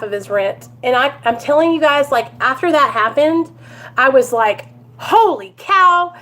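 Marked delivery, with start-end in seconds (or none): 5.0-6.1 retold elation, pitched up throughout; amazed excitement. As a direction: marvel at what you all pulled off. Retold amazement after raising money.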